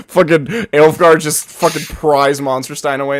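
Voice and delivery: normal talking voice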